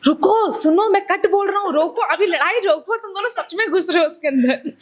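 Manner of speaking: retold high-pitched call to stop; imitating a pleading director. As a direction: break up a fight as the exasperated director. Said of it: "director को एक point पे आके बोलना पड़ा".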